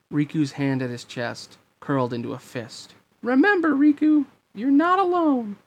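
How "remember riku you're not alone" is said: loud, squeaky falsetto encouragement; high-pitched king. A narrator's reading. King reassuring Riku from afar, very high character voice. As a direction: reassure him in a very high, bright-eyed character voice. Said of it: "he could hear the king's voice".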